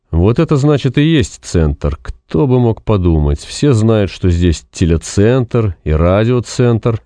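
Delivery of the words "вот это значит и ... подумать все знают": ordinary delivery, nothing marked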